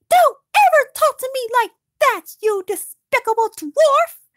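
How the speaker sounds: high, loud furious snap; snapping elf guard. Acted. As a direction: snap at him with contempt. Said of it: "Tauriel snapped in response".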